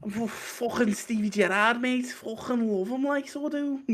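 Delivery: high hammed-up Scouser; mock Scouse accent. Scouse accent showing off for podcast friends. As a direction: play a proud Liverpool lad, pitched high. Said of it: "a really strong".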